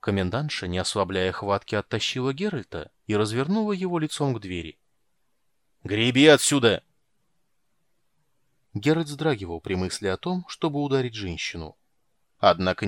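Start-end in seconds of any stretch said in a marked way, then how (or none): 5.8-6.8 angry shout. A narrator's reading. Commandant throws him out.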